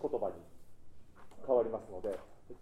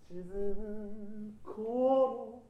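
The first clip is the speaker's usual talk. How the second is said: 沈む頃 very slow, 頃 entered from below; slow 沈む頃 with a scooped 頃; 6 semitones higher, much slower than usual. Shown as a demonstration. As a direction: slide up into 頃 of 沈む頃. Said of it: "ちょっと音程が下から入っても".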